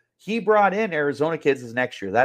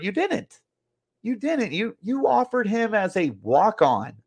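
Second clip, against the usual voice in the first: you didn't, said much higher and sharply; indignant retort; 8 semitones higher than usual. Real, not acted. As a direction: fire "You didn't" back high and incredulous. Ranting rebuttal.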